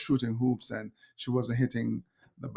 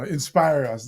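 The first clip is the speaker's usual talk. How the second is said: mocking wife; 2 semitones higher, 8 dB louder than usual. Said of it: "you know".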